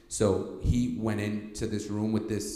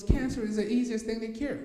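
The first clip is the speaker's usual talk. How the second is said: high, louder voice of the confident mentor; mentor's bold claim; 14 semitones higher, 4 dB louder than usual. Retold or imitated. Mentor boasting he cures cancer, in a high, louder put-on voice. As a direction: toss it off like it's nothing, pitched way up. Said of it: "He goes".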